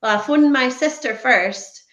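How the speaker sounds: normal talking voice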